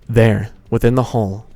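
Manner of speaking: ordinary delivery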